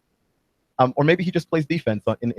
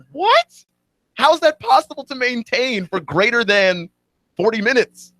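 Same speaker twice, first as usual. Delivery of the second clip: incredulous; 12 semitones higher than usual. Retold or imitated. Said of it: "And you're just like".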